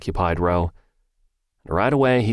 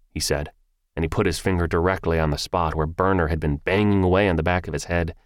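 usual speaking voice

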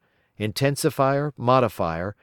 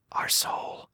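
muttered under the breath, softer; whispered insult; 7 dB quieter than usual. Acted. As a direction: hiss it to yourself. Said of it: "I whispered to myself".

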